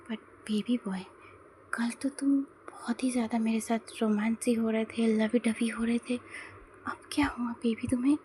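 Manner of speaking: teasing whisper, breathy and close; whispered in his ear. Acted. JK whispers teasingly into Tae's ear. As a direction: murmur it right into his ear, playful. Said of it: "ऐसा कहता है वो धीरे से उसके कान में".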